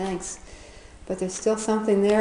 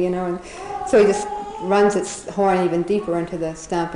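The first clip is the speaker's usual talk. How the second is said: ordinary talk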